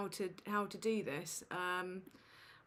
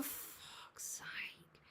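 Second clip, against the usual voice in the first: whispered curse under the breath, slow; exasperated whisper; 15 dB quieter than usual. Real, not acted. Streamer whispering to himself over the broken audio, exasperated. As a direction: hiss it out in real frustration.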